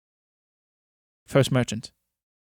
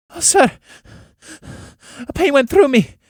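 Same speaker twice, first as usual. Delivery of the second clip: much higher, loud pained cry; 12 semitones higher, 6 dB louder than usual. Acted. Old woman cries out to the merchant in sudden pain. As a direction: cry out as pain stabs through her. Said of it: "She screams".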